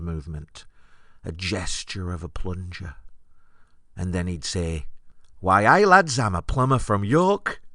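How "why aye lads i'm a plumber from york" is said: loud, high-pitched Geordie announcement; Geordie accent. Retold or imitated. Comic imitating a solo improviser starting his scene, Geordie accent. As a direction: belt it out in a put-on Geordie accent. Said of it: "it would be in a Geordie accent".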